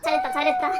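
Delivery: a touch of high cheer; mock cheering. Praising partner after the bathroom, light mock cheer. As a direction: cheer like for a child's win.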